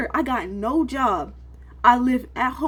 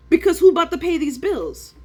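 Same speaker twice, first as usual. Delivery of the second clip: loud demand; 7 semitones higher, 6 dB louder than usual. Real, not acted. Trader venting to viewers, loud and pressing. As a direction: raise it high and loud, pressing the question. Ranting about bills.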